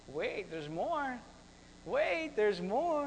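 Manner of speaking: very slow, stretched words; drawn-out announcement. Acted. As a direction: draw out each word teasingly.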